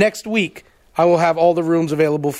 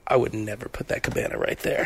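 low, much softer grumble about the cabana; 10 semitones lower, 9 dB quieter than usual. Retold or imitated. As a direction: drop to a low disgruntled aside. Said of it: "my Oasis impression of [name]".

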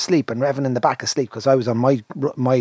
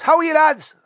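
put-on Westmeath accent; 5 dB louder than usual. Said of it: "country accent, Westmeath".

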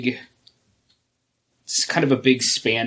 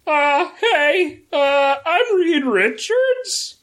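much higher, slow comic voice; Reed Richards voice; much slower than usual. Acted. Cohost reads Reed Richards' line in a much higher, slow comic voice. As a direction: squeak out a goofy hero introduction. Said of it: "A dramatic reading".

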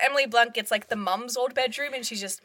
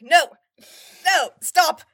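louder, higher firm refusal; mock stern mother; 6 semitones higher, 9 dB louder, slower than usual. The mum refuses her son's plea, sharp refusal. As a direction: shut him down like a bossy mum. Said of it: "she's like".

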